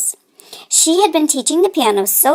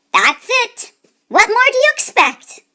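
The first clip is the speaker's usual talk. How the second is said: put-on higher, defiant kid voice; cheeky boy; 9 semitones higher than usual. Nephew talking back to his uncle, defiant. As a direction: talk back like a sulky boy.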